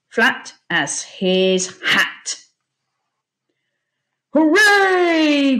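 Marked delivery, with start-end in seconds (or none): 4.3-5.6 loud, high cheer; jubilant cry. A narrator's reading. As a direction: shout hooray in relief. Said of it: "cried the Admiral".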